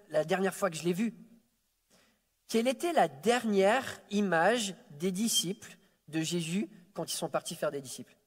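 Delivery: ordinary delivery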